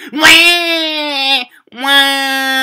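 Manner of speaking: loud, high, mocking wail. Mocking Sofia Vergara's shrieking character, loud high wail. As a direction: howl it like the annoying character in the film. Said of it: "all she does is".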